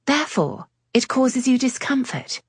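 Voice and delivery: a hint of a teasing lady; lightly voiced lady. Cleo voiced, higher.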